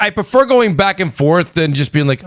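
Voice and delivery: ordinary talk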